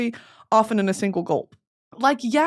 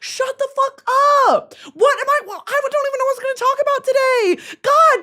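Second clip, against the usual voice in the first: frustrated yell; 5 dB louder than usual. Real, not acted. Venting at own distraction.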